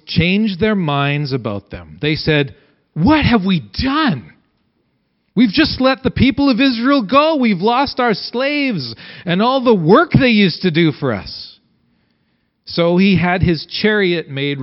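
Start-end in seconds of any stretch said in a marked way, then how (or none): 2.9-11.6 higher, aghast voice of Pharaoh's officials; dismayed officials. Acted. Pharaoh's officials lamenting the lost slaves, higher and aghast. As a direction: wail it like courtiers who just lost their slaves.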